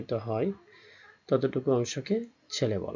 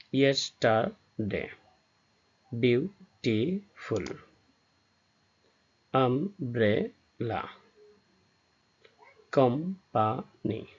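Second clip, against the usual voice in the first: "Yesterday, beautiful, umbrella, company" slowly split, a pause after each syllable; three separated syllables; much slower than usual. Shown as a demonstration. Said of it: "যে ওয়ার্ডে তিনটি syllable থাকে". ("Yesterday, beautiful, umbrella, company" ধীরে, তিন ভাগে ভেঙে ভেঙে)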